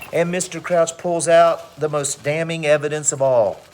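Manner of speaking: usual speaking voice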